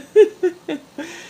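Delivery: a burst of high laughter at the memory; amused high chuckle. Real, not acted. Laughs at own story.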